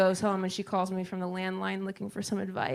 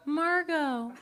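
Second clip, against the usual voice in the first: mom voice; 7 semitones higher than usual. Retold or imitated. Mom answering, higher.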